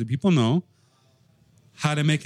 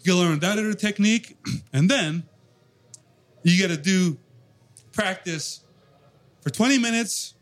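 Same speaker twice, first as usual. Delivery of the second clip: higher, sing-song mock lecture voice; mocking know-it-all teacher; 7 semitones higher than usual. Drummer mocking the advice of drum teachers, higher sing-song voice. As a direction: parrot the drum gurus' endless rules. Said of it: "But they say".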